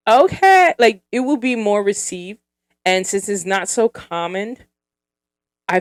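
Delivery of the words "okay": much higher, louder, delighted "Okay"; light up at the compliment. Retold or imitated. Reacting to a woman's compliment, delighted and high. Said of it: "you'd be like".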